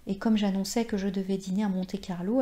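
ordinary delivery

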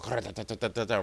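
quick, soft tongue rattle; rapid ta-ta-ta clatter; rattling noise. Retold or imitated. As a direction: imitate something rattling back and forth. Imitates the rattling thing.

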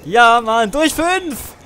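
high, loud joking yell; excited joking shout. Pretend. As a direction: hype the joke up. Joking about YouTube money.

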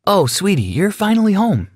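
high, sweet motherly greeting; sweet mom voice. Acted. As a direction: coo at a child coming home.

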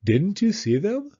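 can't believe he missed them; a little surprised. Acted. Owl surprised that Pooh missed the notices. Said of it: "a little surprised".

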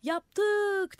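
high, loud "Yaptık!" flung up; crowd shouting. A narrator's reading. Crowd yelling up to the roof, loud. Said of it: "diye bağırdılar".

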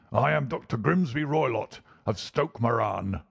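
put-on menacing old villain announcing himself; angry old doctor. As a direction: announce yourself as a forbidding old bully.

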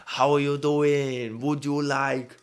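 put-on botched French accent, slow. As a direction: attempt French and let it slip. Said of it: "That went into Chinese".

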